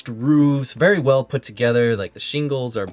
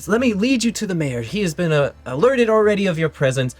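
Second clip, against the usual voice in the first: high-pitched, eager elf attendant; elf woman attendant; 9 semitones higher than usual. Acted. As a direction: usher guests along in a higher voice. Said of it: "woman elf approaches you".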